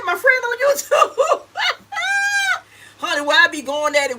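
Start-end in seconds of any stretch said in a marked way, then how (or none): 0.0-1.4 high, excited near-shout; excited high cry. Retold or imitated. Retelling excited answer to husband. 1.4-2.6 high-pitched burst of laughter. Real, not acted. Laugh after retold exclamation.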